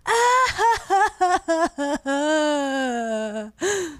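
long high wail that could be laughing or crying; drawn-out fake crying cackle; mock sobbing laugh. Shown as a demonstration. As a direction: imitate someone scream-laughing as if sobbing. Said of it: "here's my rendition is, like, sobbing".

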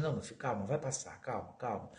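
put-on calm the hurting child tenderly; hushed parent soothing a child after a shot. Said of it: "Começa a dar carinho".

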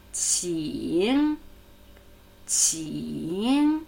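"Qing" held low, then sliding up; third tone. Shown as a demonstration. Teacher has learners listen to the tone, "Qing" in low dipping third tone. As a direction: draw "Qing" out through a low dip and rise. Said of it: "Ja, dritter Squat-Ton".

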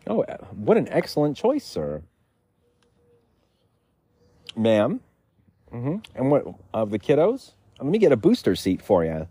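high, overly friendly server; perky waiter. Pretend. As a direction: fawn over the customers. Waiter Bling again.

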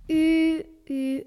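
loud, pouting "U, U", poking fun; mocking U. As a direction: pout and jeer a long "U". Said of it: "vous moquez de lui, vous ne sauriez lui dire que U".

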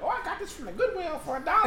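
high-pitched mock bargain brag; bragging. Mom boasting about a Goodwill bargain, imitated high bragging. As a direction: crow proudly in a put-on high voice. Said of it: "my mom used to, like, brag".

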